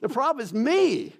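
retold desperate prayer, high and straining; anguished plea. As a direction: cry out to God like a lost young man at the end of his rope. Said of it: "I told God that".

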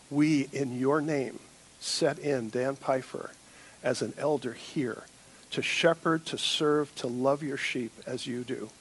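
low, soft commissioning prayer. Acted. Pastor praying over the new elder, hushed and reverent.

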